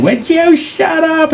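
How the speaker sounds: very high, stretched-out mock screech; exasperated mother yelling. Mom worn down by decades of crying, exasperated screech. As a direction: wail it in comic exasperation.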